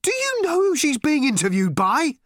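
high, loud excited question; excited call. A narrator's reading. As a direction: call through the wall, thrilled. Arthur calling excitedly.

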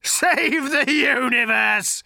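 loud, slow, scornful cry; spat with contempt. A narrator's reading. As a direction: spit the words in disgust. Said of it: "spat Agrajag with contempt".

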